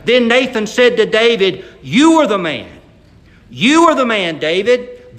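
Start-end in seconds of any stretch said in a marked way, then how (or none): 1.8-5.2 loud, weighty accusation; dramatic accusation. Acted. Nathan confronting David, loud and weighty. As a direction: point the finger and land it. Preacher delivers climactic line.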